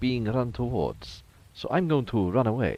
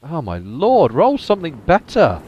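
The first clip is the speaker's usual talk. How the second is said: louder, higher, begging the roll to improve; loud plea to the dice; 6 semitones higher, 8 dB louder than usual. Real, not acted. Player yelling at his bad dice roll, exasperated loud plea. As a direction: shout at the dice like they owe you.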